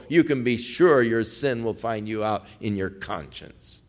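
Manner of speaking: usual speaking voice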